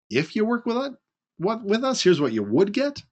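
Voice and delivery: high, rising mock question; mock unsure echo. Shown as a demonstration. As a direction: echo it back doubtfully. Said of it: "so you hear how it sounds".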